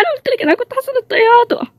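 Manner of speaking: blurting it out in a rush; frantic outburst. Acted. To Fahd about the crash, a frantic high rush. Telling him the plane crashed.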